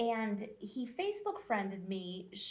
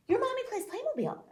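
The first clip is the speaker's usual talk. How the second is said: high, loud imitation of an amazed client; sudden discovery, played up in retelling; mock astonished recognition; 5 dB louder, faster than usual. Client recognizes his realtor, retold.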